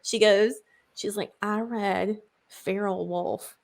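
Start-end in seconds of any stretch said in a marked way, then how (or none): 1.4-3.6 soft slow secret whisper; hushed, slow, unvoiced secret. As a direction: lean in and whisper a confession. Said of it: "she covers up her face".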